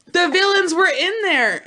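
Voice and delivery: loud, high-pitched insistence; insistent protest. Real, not acted. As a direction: insist comically over the interruption. Repeats protest over friend's objection.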